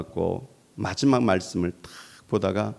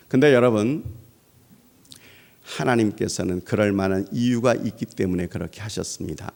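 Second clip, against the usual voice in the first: usual speaking voice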